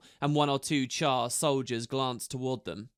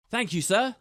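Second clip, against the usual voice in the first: higher, crisp soldier's reply; to attention; 8 semitones higher, 4 dB louder than usual. A narrator's reading. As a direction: snap to attention. Ember relieved, answering.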